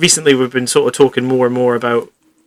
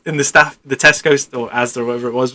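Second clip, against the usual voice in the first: ordinary talk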